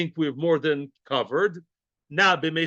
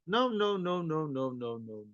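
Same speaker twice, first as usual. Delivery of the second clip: fading no's; 8 dB quieter than usual. Shown as a demonstration. Teacher repeats no, fading out.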